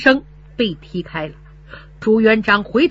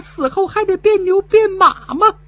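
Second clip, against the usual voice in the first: tearful lament; 10 semitones higher than usual. Acted. Old mother's bitter lament.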